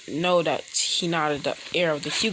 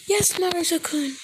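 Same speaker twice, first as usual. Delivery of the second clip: put-on high, delighted girlish voice; sweetly pleased, pitched up high; happy girl voice; 12 semitones higher than usual. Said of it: "out of enjoyment".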